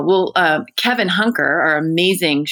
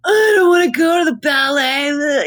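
put-on high, loud whining child; whiny kid; 10 semitones higher, 4 dB louder than usual. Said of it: "he was just bitching about".

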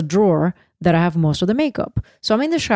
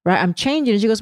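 ordinary talk, nothing marked

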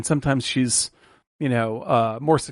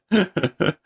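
delighted laugh; 4 semitones higher than usual. Real, not acted. Laughing at the scene.

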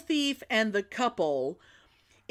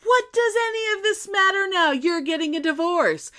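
higher, gloating mother-in-law voice; a mock-gleeful mother-in-law in a higher voice; 10 semitones higher, 4 dB louder than usual. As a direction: crow it out, delighted at the divorce. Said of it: "she's just like".

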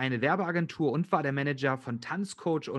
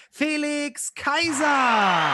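announcer call; 10 semitones higher, 5 dB louder, much slower than usual. Acted. Host announces co-host.

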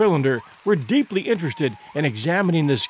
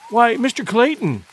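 raised, eager call; excited cry; 6 semitones higher, 3 dB louder than usual. Acted. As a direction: call out his name in surprise. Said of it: "she cried".

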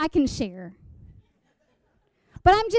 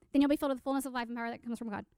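very fast, softer gabble; freaking out; 3 semitones lower, 7 dB quieter, much faster than usual. Retold or imitated. Giddy teen self ticks off the promise, rapid-fire muttered recital. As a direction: rush the whole verse in one breath. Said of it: "And I'm freaking out".

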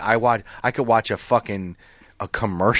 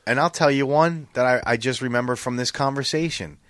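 usual speaking voice

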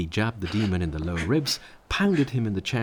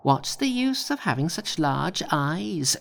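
high, mock shout; Monkey shouting; 7 semitones higher than usual. A narrator's reading. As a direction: jeer up at the demon. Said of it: "shouted Monkey".

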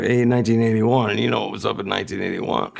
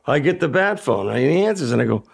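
ordinary delivery

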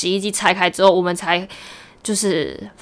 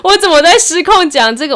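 mock panicked thought; 7 semitones higher, 6 dB louder than usual. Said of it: "想说".

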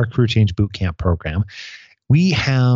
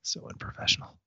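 whisper; 16 dB quieter than usual. Real, not acted. Laughing aside during bloopers.

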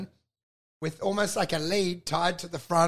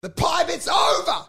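much higher shouted line; mock shout; 8 semitones higher, 4 dB louder than usual. Comedian shouting at the pie man backstage. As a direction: blurt it out loud. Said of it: "I was like".

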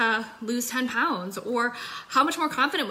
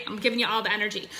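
ordinary talk

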